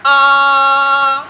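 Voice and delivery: आ stretched long over three beats; drawn-out आ like a Vedic chant; pluta vowel. Shown as a demonstration. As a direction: hold the आ steady and drawn out, triple length. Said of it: "तो अब इसका जो उच्चारण होगा वो होगा".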